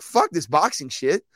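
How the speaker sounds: imitating a fed-up fighter. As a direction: quit in disgust, mock outrage. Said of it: "he's gonna be like".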